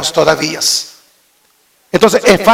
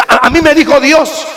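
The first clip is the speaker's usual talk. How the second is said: mock boastful member; 6 semitones higher than usual. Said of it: "miembros que vienen diciendo así".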